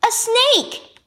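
put-on high-pitched girl calling out; called-out guess. A narrator's reading. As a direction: call out the answer, high and eager. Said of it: "called out one of the girls".

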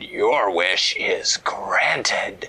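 slow, breathy whispered magic voice; wish dragon voice. A narrator's reading. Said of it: "in her wish dragon voice".

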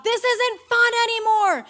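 high-pitched mock panicked yell; retold yell. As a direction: yell it out as if stuck up a tree. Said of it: "I yelled out loud".

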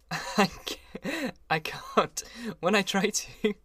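high-pitched words through laughter; can't get it out for laughing; laughing helplessly. A narrator's reading.

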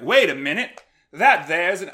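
a hint of a 1940s transatlantic accent for Cap. Podcaster voicing Cap spotting the depot, light transatlantic accent. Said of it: "I give Cap, like, a transatlantic accent".

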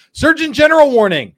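loud, high announcer call; mock official announcement. Host announces a parody label to viewers, mock official announcement. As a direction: proclaim it like an official alert.

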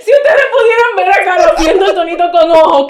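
high shrieking laugh, words through it; can't stop laughing; shrieking with laughter while talking; helpless laughter. Real, not acted. Laughing at Carlos's eye-roll.

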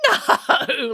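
high, drawn-out laughing no. A narrator's reading. Little man rejects the Queen's guess, laughing. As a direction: laugh right through the word. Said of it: "laughed the man".

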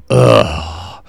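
breathy unvoiced teen groan of disgust; a whispered, fed-up ugh like a teenager; daughter's disgusted ugh. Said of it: "she'll either go".

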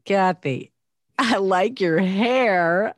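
slow, loud "I like your hair", rising on "hair"; different emphasis. Shown as a demonstration. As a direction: punch out "I like your hair" slowly and forcefully. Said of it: "just a total different emphasis".

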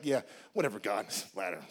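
low, soft grumbling with a laugh; muttered grumble. Retold or imitated. Preacher grumbling to God about the ladder, low. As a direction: mutter it off under your breath.

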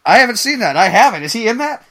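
excited. Real, not acted. Eager reaction to the Congo news.

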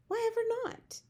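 very high-pitched challenge; incredulous. A narrator's reading. Sophie questions the giant's refusal, incredulous. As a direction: squeak it out in disbelief.

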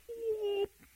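high squeaky whoop; a sudden high yelp. Retold or imitated. Jesus interrupting the men, a high whoop. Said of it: "Jesus goes".

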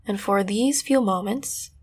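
calm, unhurried meditation guide voice; guided meditation. Acted. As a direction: soothe them gently into the scene.

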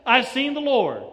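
I've said high and loud with joy; Mary telling disciples, loud and joyful; excited announcement. Acted. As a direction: burst out with the good news.

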